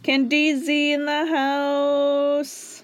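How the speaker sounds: slow, drawn-out hype announcer. Pretend. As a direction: announce him like a club DJ. He sounds like a D J.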